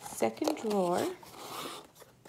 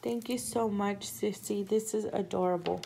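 ordinary talk

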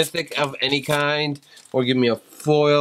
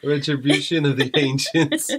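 hammed-up fake Southern accent; mock Southern accent. Husband his wife can't take seriously, mock Southern accent. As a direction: read the title in a silly Southern voice. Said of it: "If you're Southern and talk like this".

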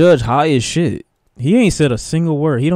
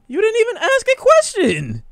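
much higher exasperated outcry; exasperated yell. Real, not acted. Viewer yelling at a courtroom show, exasperated. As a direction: throw your hands up at the screen.

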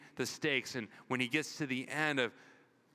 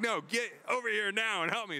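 high, urgent mock plea for help; a hint of a panicked wife, pitched up; frantic wife; 8 semitones higher, 3 dB louder than usual. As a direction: play the panicked wife calling him over. Wife's frantic call, retold.

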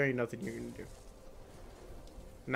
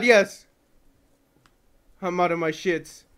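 normal talking voice

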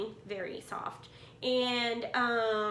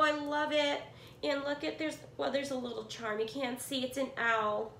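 ordinary talk

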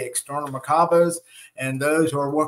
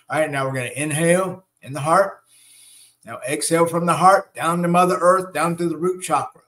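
guided meditation. Acted. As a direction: guide them through each breath, unhurried.

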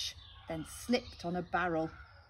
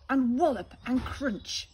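battle narration; 5 semitones higher, 10 dB louder than usual. A narrator's reading. Pirate battle.

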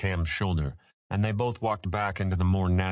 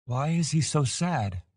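higher, voice tight with tears; 8 semitones higher than usual. A narrator's reading. Boy near tears asking about the lonely dragon, higher and tight. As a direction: hold back tears as you ask it. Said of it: "his voice tight with tears".

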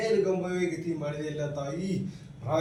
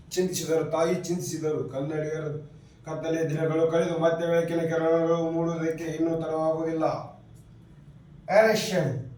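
ordinary delivery

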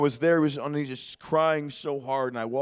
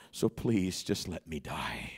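much lower, much softer, slow, nearly gone; retold despair, almost breathless; broken plea; 10 semitones lower, 10 dB quieter, slower than usual. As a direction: fade out like a man with nothing left. Retelling grieving man's plea.

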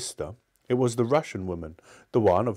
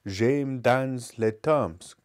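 French recitation; much slower than usual. Acted. Reciting French poem line.

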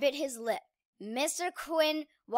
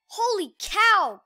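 gasping; 6 semitones higher, 7 dB louder, slower than usual. Acted. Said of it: "gasped Conrad".

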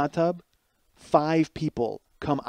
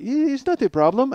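mock German politeness; 9 semitones higher than usual. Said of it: "That was my favorite line".

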